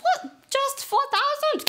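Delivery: high-pitched incredulous outburst; exaggerated high disbelief; mock amazement. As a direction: act astonished, pitch leaping up. Mock amazement at salary cut.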